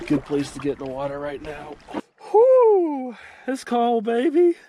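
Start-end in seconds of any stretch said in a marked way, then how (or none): 2.3-4.6 loud, high cheer in the cold; excited whoop. Real, not acted. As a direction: whoop it out in the freezing water. In icy water, cheering.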